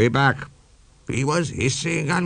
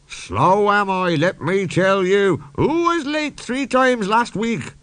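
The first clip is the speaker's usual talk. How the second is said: indignant retort; 9 semitones higher, slower than usual. A narrator's reading. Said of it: "we quarrelled".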